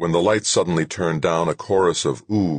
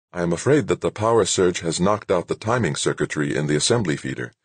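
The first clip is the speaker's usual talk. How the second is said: plain reading voice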